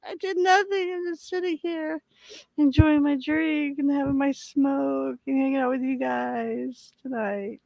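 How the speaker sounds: high, wailing mock innocence; mock innocent whine. Guest pleads innocence to viewers about the broken stream, mock wail. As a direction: play the wronged innocent, half-crying in jest. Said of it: "It's like".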